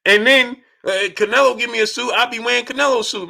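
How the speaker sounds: ordinary talk, nothing marked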